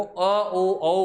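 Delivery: अ, ओ, औ each separate and emphatic; vowels sounded out. Shown as a demonstration. Teacher says students must master pronunciation, vowels sounded out. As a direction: build up from अ through ओ to a gliding औ. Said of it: "उच्चारण करना आना चाहिए आपको".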